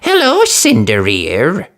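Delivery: slow mocking jeer. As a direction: taunt her sing-song.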